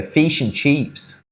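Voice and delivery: "Fish and chips" slow, vowels pulled to "feesh" and "cheeps"; Australian accent. Shown as a demonstration. Showing how Australians say it, slow and drawn out. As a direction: stretch "Fish and chips" out like an Aussie. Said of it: "What do they say?".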